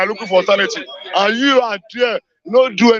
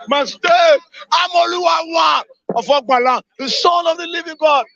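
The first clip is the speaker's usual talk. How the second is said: furious shout; 7 semitones higher than usual. Real, not acted. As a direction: yell your outrage straight at the governor. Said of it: "I'm crying".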